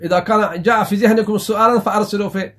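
normal talking voice

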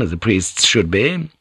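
usual speaking voice

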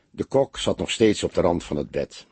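plain reading voice

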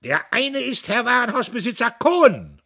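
loud, high triumphant roar; loud, high proclamation; bellowing. A narrator's reading. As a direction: throw the name at the hall. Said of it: "und er brüllte".

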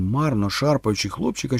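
ordinary delivery

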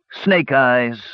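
mildly mournful dwarf; sad dwarf. A narrator's reading. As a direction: admit the losing throw sadly. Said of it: "said the dwarf sadly".

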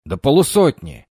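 loud lookout shout; shouted warning. Acted. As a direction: yell the alarm from the roof. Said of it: "выкрикнул один из бойцов".

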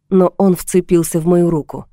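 ordinary delivery, nothing marked

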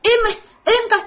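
high, annoyed shout; scolding the dogs. Retold or imitated. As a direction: snap at the barking dogs. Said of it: "bạn còn chửi tụi nó nữa".

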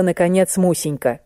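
ordinary delivery

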